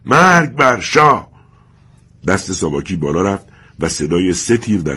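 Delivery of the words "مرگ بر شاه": very loud, much higher, slow defiant cry; defiant shout. Acted. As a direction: hurl "مرگ بر شاه" at them, long and loud. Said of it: "چنان فریاد کشید".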